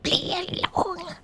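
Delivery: ordinary delivery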